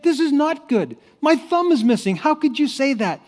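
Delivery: high, loud indignant protest; very angry king. Acted. King contradicting his cheerful servant, very angry. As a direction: throw it back at him in outrage. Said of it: "He was so angry".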